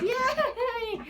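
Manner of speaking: high little cheer; squeaky "yay". Real, not acted. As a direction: celebrate the swimsuit episode.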